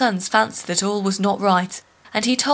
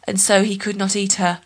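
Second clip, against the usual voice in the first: ordinary delivery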